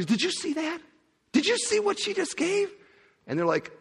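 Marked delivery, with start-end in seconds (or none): 0.1-2.7 high, lively questions; eager Jesus. Retold or imitated. Jesus showing his disciples the widow's gift: high excited. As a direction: point it out with eager wonder.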